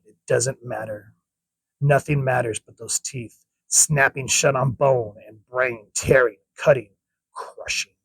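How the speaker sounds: a hushed horror read, lingering on the teeth; ominous narration. A narrator's reading. Ominous narration of alien lore.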